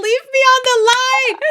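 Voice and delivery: very high squeal through laughter; laughing imitation. Retelling Rick's order to stay connected, laughing. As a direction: squeeze the mimicked order out while cracking up.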